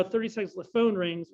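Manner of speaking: ordinary talk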